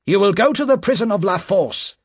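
high, loud harsh sentence; rough official. A narrator's reading. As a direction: pronounce the sentence coldly. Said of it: "Another official asked roughly".

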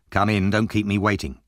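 plain reading voice

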